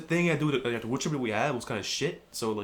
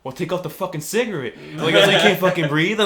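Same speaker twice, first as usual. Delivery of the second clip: raised, high dad comeback, building louder; angry retort; 6 semitones higher, 9 dB louder than usual. Retold or imitated. Dad snapping back at a complaining smoker, angry retort. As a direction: throw it back at him, loud and exasperated.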